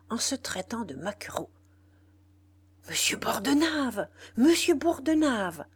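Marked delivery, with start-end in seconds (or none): 2.9-5.6 loud, high, panicked calls; alarmed calling out. A narrator's reading. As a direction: call for the boss in alarm. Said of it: "vint dire le régisseur effaré". (appel affolé, fort et aigu)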